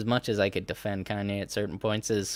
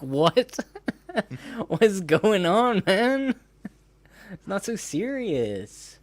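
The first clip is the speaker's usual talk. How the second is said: high, loud, drawn-out mock disbelief; 11 semitones higher, 5 dB louder, much slower than usual. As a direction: imitate someone loudly baffled, much higher in pitch. Mimics [name] incredulously brushing it off.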